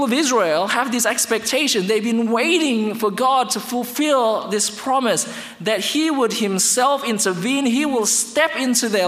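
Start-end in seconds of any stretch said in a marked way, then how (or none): none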